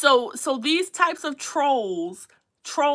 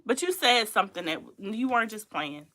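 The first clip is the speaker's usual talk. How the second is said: usual speaking voice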